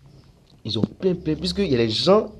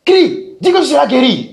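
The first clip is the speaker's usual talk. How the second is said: much higher, much louder healing cry; impassioned faith-healer shout; 13 dB louder than usual. Retold or imitated. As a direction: yell the promise of healing at the crowd. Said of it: "Le gars crie".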